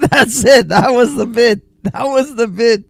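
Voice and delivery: high, tickled delivery through laughter; amused, laughing. Real, not acted. Reactor recognizes the bit in the clip, high and laughing. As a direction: say it while cracking up.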